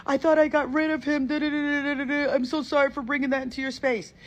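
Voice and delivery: high, fast, breathless mock sob voice; crying girl. Teller imitates the crying girl apologizing to her. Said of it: "she started crying. She's like".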